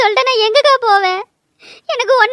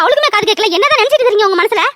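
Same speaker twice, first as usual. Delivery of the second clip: ordinary talk, nothing marked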